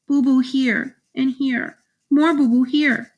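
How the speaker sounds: put-on high baby-talk voice; toddler showing hurts. A narrator's reading. Toddler shows big brother his wounds, high baby talk. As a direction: point out each hurt like a little child.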